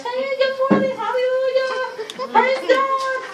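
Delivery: high, loud, cheery church hello; loud church greeting. Retold or imitated. Wife greeting the church folk, loud and high. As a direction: beam it out to the congregation.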